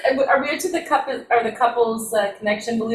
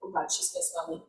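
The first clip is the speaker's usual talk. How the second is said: quick, unvoiced whisper; whispered thought; 15 dB quieter, faster than usual. Host voices the character's inner worry, fast whisper.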